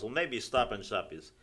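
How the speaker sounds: ordinary talk, nothing marked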